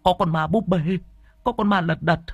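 panicky, rising cry for help; frightened son begging grandma Bu for help; scared son. Acted. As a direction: babble in fear of the ghost. Drunk son frightened by ghost.

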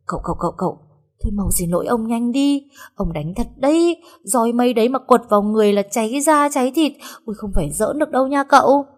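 put-on a scared girl nudging her master; frightened urging. Sen urgently begging master. (giọng hoảng sợ thúc giục)

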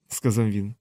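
plain reading voice

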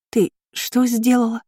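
incredulous, drawn-out question; stunned question. A narrator's reading. Shocked reaction to a confession.

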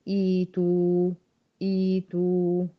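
إيتو very slow, starting on a clear kasra إي and ending on a rounded تو; slow pronunciation demo. Shown as a demonstration. Teacher models how to start the word for students, slow and precise. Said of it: "عند البدء هقول إيتو".

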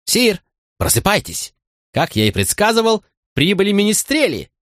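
playful court fool announcing; jester voice. Acted. Fool wakes the king theatrically. (высокий шутовской голос)

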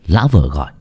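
usual speaking voice

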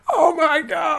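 high-pitched lone fan's cry, laughing; drawn-out high mock exclamation; mock crowd yell. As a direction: imitate one overexcited man in a crowd. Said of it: "one guy in the crowd went".

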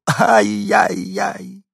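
very high, very slow breathy wail; little dog mournfully wailing after his friends. A narrator's reading. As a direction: let the sorrow stretch out. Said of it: "горестно добавил".

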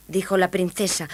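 usual speaking voice

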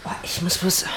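hushed. Acted. Hiding a phone call, hushed.

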